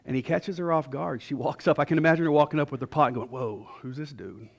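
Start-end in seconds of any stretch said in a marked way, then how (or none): 3.3-4.5 wary aside. Acted. Woman startled by stranger, imagined aside.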